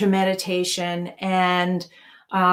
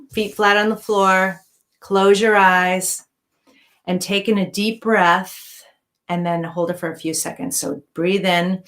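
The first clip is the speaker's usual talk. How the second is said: guided meditation; 4 dB quieter than usual. Acted. Guides breathing and relaxation.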